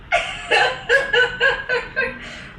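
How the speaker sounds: long, high, tearful laugh. Real, not acted. Remembering the ten thousand dollar stipend, long high laugh. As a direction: laugh through joyful tears.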